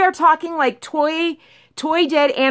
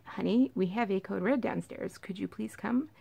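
much softer, half-whispered polite request; the sensible quiet way to ask for help; calm phone call; 4 semitones lower, 13 dB quieter than usual. Pretend. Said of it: "and be like".

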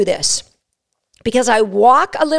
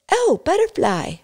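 a high, soft, distracted dog voice; the dog suddenly spots a butterfly; 6 semitones higher, 4 dB quieter than usual.

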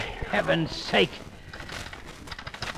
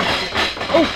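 sudden high startled cry; 5 semitones higher than usual. Acted. Elmer hears the lamp crash, startled cry. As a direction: jump at the crash.

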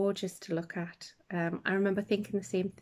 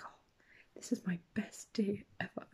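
imitating Jeff Goldblum; 8 dB quieter than usual. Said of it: "he'll come out with things".

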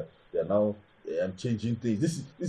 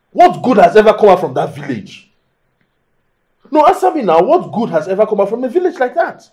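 heated, raised-pitch outburst; angry shouting; 6 semitones higher, 7 dB louder than usual. Acted. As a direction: demand an answer, loud and high. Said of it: "you, you, you're shouting".

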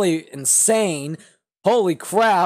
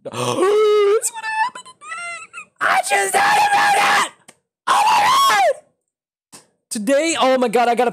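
high-pitched yelling in sudden excitement; excited shout; 6 dB louder, slower than usual. Real, not acted. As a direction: shriek it out, a huge realisation hits. Sudden realisation of South Park tonight.

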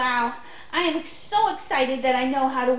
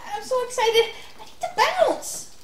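giddy; 7 semitones higher than usual. Acted. Child bouncing excitedly.

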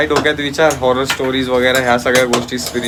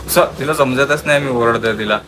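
ordinary talk, nothing marked

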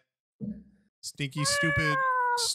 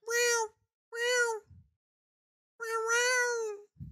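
much higher, squeaky mock meows; mocking cat meow; much slower than usual. Streamer mocking a friend's cat, squeaky mock meowing. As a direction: mimic a dumb cat meowing at you. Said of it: "He's probably over here like".

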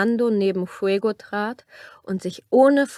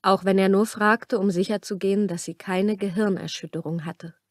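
ordinary delivery

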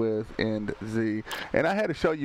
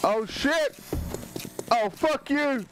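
startled panicked yell; 11 semitones higher, slower than usual. Real, not acted. Monster attacks the player.

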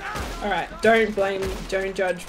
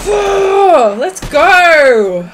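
loud, high celebratory holler; triumphant yell; 7 semitones higher, 12 dB louder than usual. Real, not acted. Player celebrating a win, triumphant yell. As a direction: holler it out in victory.

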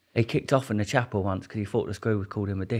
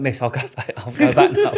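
laughing plea; 5 dB louder than usual. Retold or imitated. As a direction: beg to go back, cracking up. Begging guard, laughing retelling.

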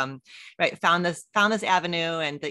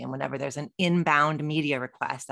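usual speaking voice

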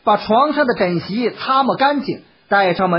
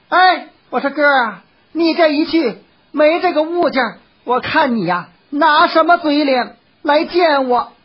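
high, pointed woman's voice; goading courtesan; 6 semitones higher, 3 dB louder than usual. A narrator's reading. Courtesan calling from the doorway to her departing patron, goading. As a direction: call out a challenge, slowing at the end.

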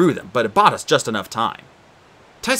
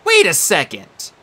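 protesting; 5 semitones higher, 5 dB louder, slower than usual. Acted. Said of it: "Annabeth protested".

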